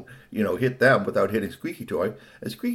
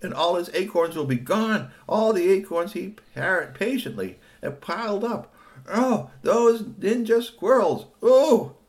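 indignant lament building higher and louder; unhappy outrage; 7 semitones higher, 4 dB louder than usual. Acted. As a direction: work up from pity to loud, high exasperation. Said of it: "she wasn't very happy".

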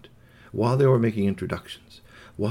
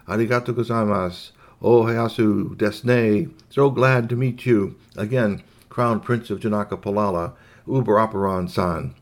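Japanese robot greeting; 4 dB louder than usual. Acted.

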